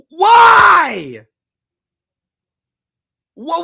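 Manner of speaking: real rage, screamed high and loud; furious shouting. Real, not acted. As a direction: build from yelling to full-throat screaming at the coaches. Fan enraged at coaching decision.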